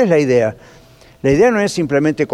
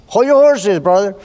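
God stepping in; 4 semitones higher, slower than usual. Retold or imitated. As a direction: rein him in like a stern friend. God halting the preacher.

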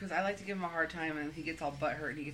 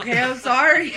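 eating while talking; 7 semitones higher, 16 dB louder than usual. Real, not acted. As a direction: mouth full, rushing to swallow. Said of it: "I'm trying to eat this as fast as possible".

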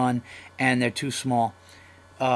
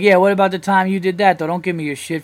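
loud, higher teasing comeback; mock comeback; 5 semitones higher, 6 dB louder, faster than usual. Comic firing back at teasing friends, loud. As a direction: fire back at a buddy, half-joking.

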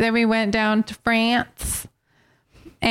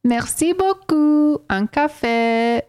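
put-on French accent; 2 semitones higher, slower than usual. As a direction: savour each word like a mock Parisian. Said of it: "she makes different accents".

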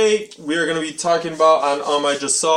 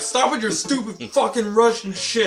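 mock Russian accent, slow and higher; 9 semitones higher than usual. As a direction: snap it in a hammed-up Russian accent. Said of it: "You should have been like".

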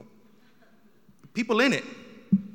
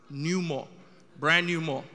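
New mall with NEW punched; stress on NEW; 5 dB louder than usual. Shown as a demonstration. Comic insisting on the New in Brand new mall. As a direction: hit New and Brand new hard. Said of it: "I gotta emphasize that".

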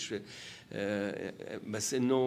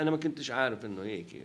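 normal talking voice

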